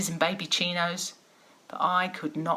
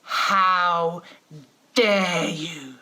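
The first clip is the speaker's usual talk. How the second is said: louder, stern and drawn out; lowered authority voice; 5 dB louder, much slower than usual. Retold or imitated. Mother lowering her voice to cow her defiant kids, stern and slow. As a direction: pull yourself up to full parental authority. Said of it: "I lower my voice to lift my sense of authority".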